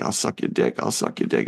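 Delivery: low whisper, pleading; hushed whispered offer; whispered junkie plea. Retold or imitated. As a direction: breathe it low and desperate. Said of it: "And he's like".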